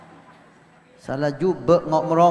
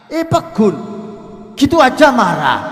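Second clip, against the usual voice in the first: high, loud scoffing jab; a dismissive wife mocking her husband's temper; scornful wife; 7 semitones higher, 6 dB louder than usual. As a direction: sneer at him for getting angry. Nagging wife voiced mocking husband.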